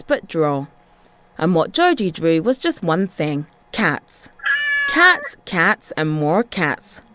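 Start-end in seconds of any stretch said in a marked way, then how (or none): none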